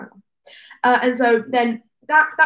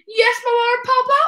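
put-on obedient little boy; 10 semitones higher than usual. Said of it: "I went".

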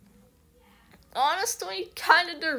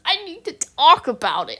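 louder, higher burst of urgency; emphatic outburst; 5 semitones higher, 9 dB louder than usual. Real, not acted. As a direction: blurt it out, louder. Repeats the line, first loud.

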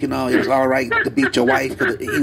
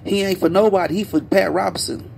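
emphatic retort; 6 semitones higher than usual. Retold or imitated. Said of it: "So you're like".

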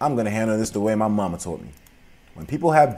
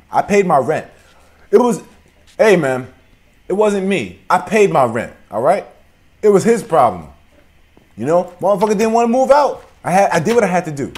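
higher, louder protest; heated self-defence; 7 semitones higher, 7 dB louder than usual. Acted. Squatter shouting at villagers that he paid rent. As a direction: argue your innocence hard.

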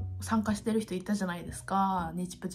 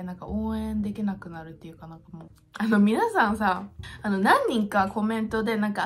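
ordinary delivery

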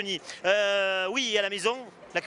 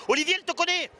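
calling across to Olivier, pitch up high; excited call to the chef across the room; 9 semitones higher, faster than usual. Real, not acted. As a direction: shout it over to him, delighted.

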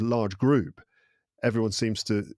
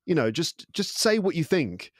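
higher-pitched, mock exasperated demand; impatient bluntness; 7 semitones higher than usual. Direct culture to wishy-washy Brits, impatient. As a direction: push them for a straight answer.

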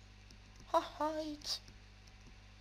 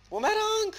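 startled cry; 6 dB louder than usual. Real, not acted. Sudden alarmed cry.

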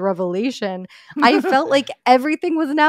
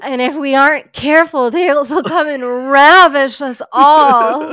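melodramatic; 5 semitones higher, 9 dB louder than usual. Pretend. Mock melodramatic fearful warning.

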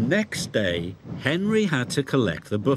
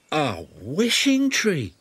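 slow, higher amazed question; gasping; 3 semitones higher, slower than usual. A narrator's reading. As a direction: gasp in wonder. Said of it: "gasped Henry".

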